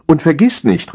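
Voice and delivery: high-pitched parting reminder; calling after him. A narrator's reading. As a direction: call it after a departing husband. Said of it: "rief sie ihm nach".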